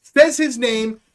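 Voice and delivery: ordinary delivery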